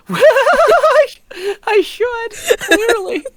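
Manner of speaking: high-pitched laughing through the words; giggling hard while answering. Real, not acted. Laughing at question about accent.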